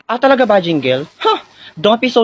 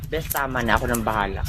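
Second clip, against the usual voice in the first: low, soft reassurance; quietly calm your friend down, voice dropped; 8 semitones lower, 8 dB quieter than usual. Acted. Trisha telling a defending best friend to stop, softly calming.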